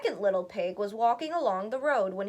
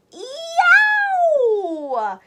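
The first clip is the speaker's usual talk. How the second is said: very high, loud yelp of pain; scalded yelp; 13 dB louder than usual. A narrator's reading. Wolf lands in boiling pot, yelping. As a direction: shriek as if burned. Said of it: "He leaped up with a scream".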